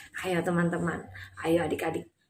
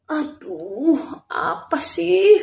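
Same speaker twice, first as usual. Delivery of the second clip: just woken; 5 semitones higher, 4 dB quieter, much slower than usual. Acted. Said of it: "Tuhan Yesusnya kebangun nih".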